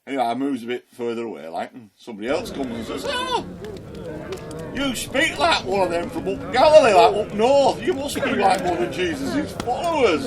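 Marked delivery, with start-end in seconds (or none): 3.1-10.2 much higher, louder accusing voice; loud accusing stranger. Retold or imitated. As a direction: shout the accusation across the courtyard. Second accuser names his accent.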